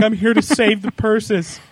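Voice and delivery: high, slow, put-on heroic voice; a grand charity hero; mock purse rescuer. Teasing the purse lover in a slow mock heroic voice. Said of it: "Be like".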